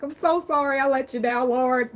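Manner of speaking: dramatic mock sob to the Lord; mock crying. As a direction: overdo a tearful apology to the Lord for laughs. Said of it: "I'll be crying like".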